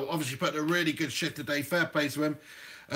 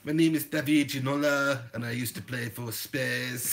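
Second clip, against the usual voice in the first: badly faked Frenchman, sinking softer; David Ginola; 7 semitones lower, 3 dB quieter than usual. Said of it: "I was trying to be David".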